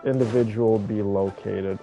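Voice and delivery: ordinary talk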